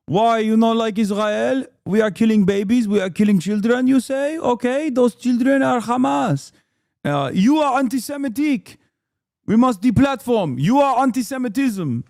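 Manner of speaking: high, loud mocking Israeli accent. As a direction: ham up an Israeli accent, sneering. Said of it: "he does this accent a lot".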